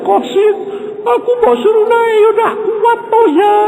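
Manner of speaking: high, strained, pleading woman's voice; a worried wife pleading to be pulled up the cliff; Nani's pleading voice. Retold or imitated. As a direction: beg him in a high, strained voice. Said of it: "Dia bilang".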